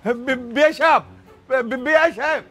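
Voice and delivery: high, stuttering call; stammered calling out. Acted. Man calls after his in-law, stammering and high. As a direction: stumble on the first syllable as you call out.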